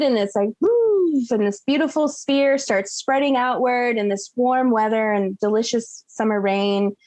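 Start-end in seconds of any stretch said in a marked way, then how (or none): 0.6-1.2 high whooping woo; mock cheer. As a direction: let the woo fly up high. Character cheers attunement.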